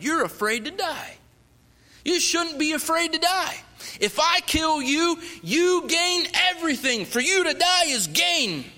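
high, loud, slow preaching; robber preaching. Retold or imitated. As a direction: preach at him with full force. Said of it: "He starts preaching to me".

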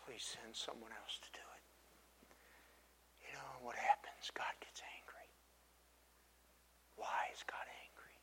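slow, breathy stage whisper; a secret told slowly in a whisper; dramatic whisper. Acted. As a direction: draw them in with a hush. Dramatic hushed retelling of Moses.

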